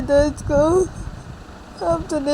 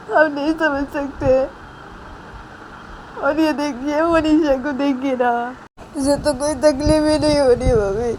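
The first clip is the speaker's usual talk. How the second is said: long tearful wailing complaint; acted crying. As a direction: plead through mock sobs that nobody understands. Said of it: "क्या बच्चों की तरह कर रही हो". (रोते-बिलखते हुए शिकायत)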